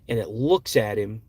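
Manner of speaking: normal talking voice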